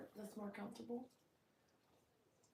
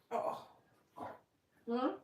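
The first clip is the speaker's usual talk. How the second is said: normal talking voice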